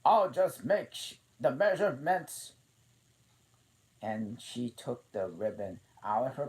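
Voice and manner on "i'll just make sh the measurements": high, loud queenly voice; the Queen preparing to measure. A narrator's reading. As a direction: announce it briskly.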